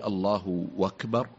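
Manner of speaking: "الله أكبر" drawn out slowly with a w gliding into "أكبر"; waw inside the takbir. Shown as a demonstration. Questioner showing the sheikh the doubtful takbir, "أكبر" said as "wakbar". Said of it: "واو في وسط التكبير".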